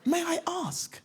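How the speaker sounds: high, shy little question; timid kid. Retold or imitated. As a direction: ask politely like a small boy.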